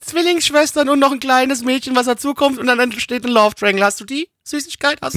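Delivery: put-on high, pushy child demanding sweets; whiny kid voice. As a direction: beg like a greedy little kid. Sketch, kid orders sweets.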